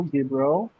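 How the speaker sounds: ordinary talk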